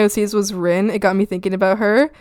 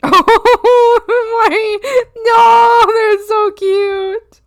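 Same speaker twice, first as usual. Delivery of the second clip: very high, loud squeal; gushing over cuteness; 11 semitones higher, 7 dB louder, much slower than usual. Real, not acted. Streamer seeing the cute frogs, gushing squeal. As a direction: squeal and stretch it out adoringly.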